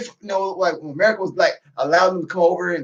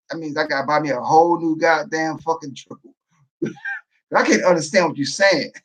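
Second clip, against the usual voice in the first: ordinary talk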